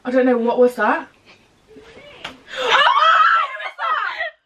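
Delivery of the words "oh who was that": high, slow, frightened laughing cry; scared shriek that tips into laughter; startled fright. Real, not acted. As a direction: gasp it out, spooked and giggling. Startled by ghostly noise.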